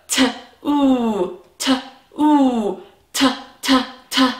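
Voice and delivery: "T, oo" stretched into separate sounds; sounding out. Shown as a demonstration. Teacher sounding out the word two for children, stretched. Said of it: "stretch it out and hear all of those sounds".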